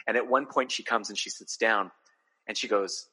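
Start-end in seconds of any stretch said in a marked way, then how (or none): none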